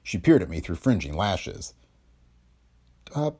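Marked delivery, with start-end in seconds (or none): 3.1-3.3 hesitant, higher-pitched fib; unconvincing excuse. A narrator's reading. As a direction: offer a feeble excuse after a halting start. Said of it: "she said unconvincingly".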